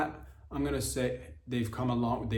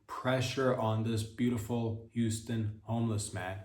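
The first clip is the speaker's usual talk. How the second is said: ordinary talk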